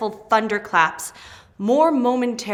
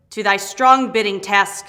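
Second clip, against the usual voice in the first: ordinary delivery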